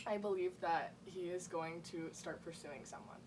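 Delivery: ordinary talk, nothing marked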